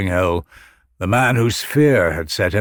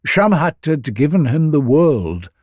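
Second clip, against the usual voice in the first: usual speaking voice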